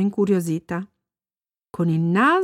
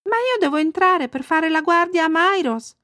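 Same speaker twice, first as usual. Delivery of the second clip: put-on high child's voice, objecting; protesting little girl; 8 semitones higher than usual. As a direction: plead your case as a little girl. Bambina protesta col vecchietto. (voce acuta da bambina che protesta)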